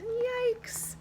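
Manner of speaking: high squeaky mock alarm; mock yikes. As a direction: squeal it in play fright. Reacting to shark.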